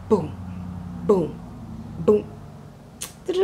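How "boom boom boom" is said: punchy spoken booms for the beat drop; imitated bass beat. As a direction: thump out the beat with your voice. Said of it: "So the music was like".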